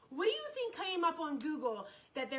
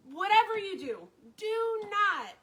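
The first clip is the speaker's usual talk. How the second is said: loud, higher warning with weight on DO NOT; retold doctor's firm, raised-voice warning; emphatic warning; 6 semitones higher, 8 dB louder than usual. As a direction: hammer DO NOT like a doctor's order.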